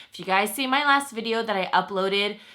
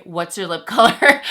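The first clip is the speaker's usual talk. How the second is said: laughing; 8 dB louder than usual. Retold or imitated. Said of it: "everybody was like".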